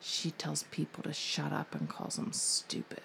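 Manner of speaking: put-on whispering child telling on her sister; whispered tattle. Daughter whispers to dad about her sister's bad words. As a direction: hush it like a secret tattle.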